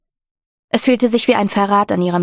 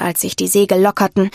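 ordinary delivery, nothing marked

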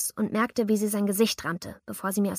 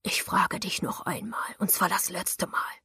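slow, low whispered warning; hissed threat; 3 semitones lower than usual. A narrator's reading. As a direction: hiss each word as a last warning. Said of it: "zischte er".

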